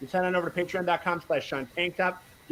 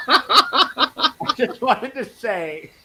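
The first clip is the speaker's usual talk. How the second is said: slow and loud through laughter; laughing; 5 semitones higher, 8 dB louder, much slower than usual. Real, not acted. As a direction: push the words out while laughing. Laughing after the joke.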